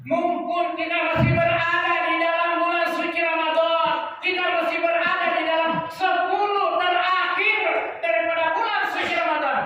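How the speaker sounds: high, insistent preaching; full-force exhortation. Real, not acted. Preacher urges congregation to use Ramadan's last days, high and forceful.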